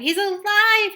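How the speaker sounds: high, loud desperate cry; boy insisting through tears; grieving boy insisting his dog lives. A narrator's reading. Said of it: "Tim cried and cried".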